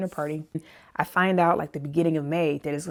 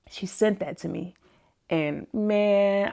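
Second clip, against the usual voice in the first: ordinary talk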